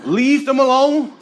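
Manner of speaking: high, loud, slow dog-owner call. Retold or imitated. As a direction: call him off like an unruly dog.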